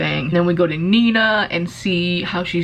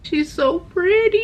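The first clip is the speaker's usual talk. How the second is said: cooing; 11 semitones higher than usual. Real, not acted. Admiring the new book's cover.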